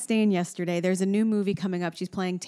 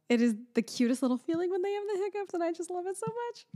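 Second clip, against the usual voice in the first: gushing; 12 semitones higher, 3 dB quieter, faster than usual. Real, not acted. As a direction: coo like you adore it. Gushing over baby's hiccups.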